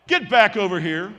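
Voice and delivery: loud shouted command; coach's order. Retold or imitated. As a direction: call a straying player back sharply. Coach orders the boy back.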